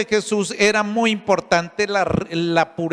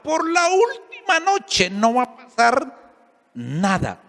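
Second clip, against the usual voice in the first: high mocking echo of the lover's promise; bitter mocking echo; 6 semitones higher than usual. Preacher echoes the lover's promise to the congregation, in a high mocking tone. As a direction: throw his empty promise back, higher and sharper. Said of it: "ella misma después lloraba y decía".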